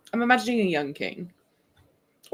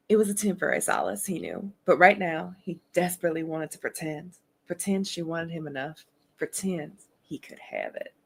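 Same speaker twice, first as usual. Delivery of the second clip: put-on Southern accent. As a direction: read it as a Southern romance narrator. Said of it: "Can you do a Southern accent?".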